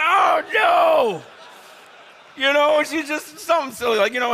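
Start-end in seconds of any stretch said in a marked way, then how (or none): 0.0-1.2 loud, high, drawn-out oh no; loud mock dread. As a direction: cry out in comic alarm. Dreading the question put to him.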